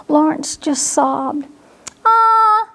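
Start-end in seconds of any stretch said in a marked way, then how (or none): 2.0-2.7 sudden high knowing ah; a bright dawning ah from papa; lightly voiced papa; papa fox.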